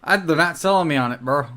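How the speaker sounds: usual speaking voice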